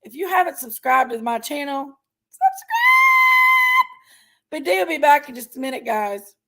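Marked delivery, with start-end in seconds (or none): 2.3-3.9 very high, loud drawn-out shout; shouted plea. Acted. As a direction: belt it out to the viewers. Urging viewers to subscribe.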